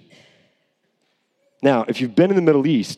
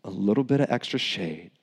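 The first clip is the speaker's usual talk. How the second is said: soft, hushed, self-satisfied muttering; a lightly voiced Jonah, pleased with his shade; smug Jonah; 5 semitones lower, 7 dB quieter than usual. Jonah enjoying his shade, hushed and smug. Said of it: "It's like".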